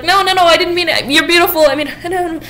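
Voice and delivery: high, loud, hurried mock panic; lightly voiced flustered suitor; flustered backpedaling. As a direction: scramble to take it back. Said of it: "Monty's trying to fix it".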